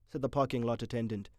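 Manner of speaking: usual speaking voice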